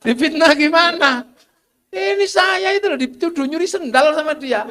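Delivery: high, loud playful goat complaint; mock goat voice. Preacher voicing a goat complaining to God, playful high. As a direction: whine like a wronged goat.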